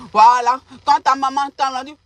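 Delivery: ordinary talk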